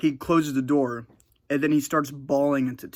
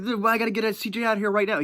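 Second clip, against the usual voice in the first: urgent plea; 9 semitones higher, 4 dB louder, faster than usual. Retold or imitated. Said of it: "She's like".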